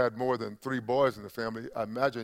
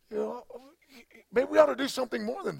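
agitated; 12 semitones higher, 4 dB louder, faster than usual. Retold or imitated. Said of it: "I would be a little agitated".

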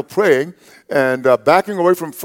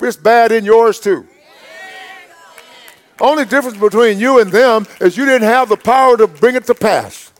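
raised, ringing preacher's voice, slowing for emphasis; full-force preaching; 5 semitones higher than usual. Acted. Pastor rousing his church to stay untroubled, preaching hard. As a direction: drive it home to the congregation.

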